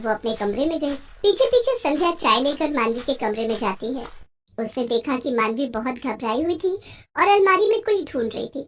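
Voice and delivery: plain reading voice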